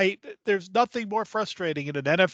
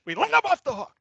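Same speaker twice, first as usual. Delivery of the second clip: Denny Green impression; 10 semitones higher than usual. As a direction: rant like a furious coach at a presser. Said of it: "the Bears coach impression there".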